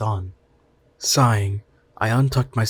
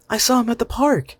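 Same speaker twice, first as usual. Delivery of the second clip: put-on high, bright little boy voice, a bit louder; child voice; 14 semitones higher, 5 dB louder than usual. Mother voicing her son explaining how he met Todd, high child voice. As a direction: voice the young son telling his story. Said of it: "Mason told me".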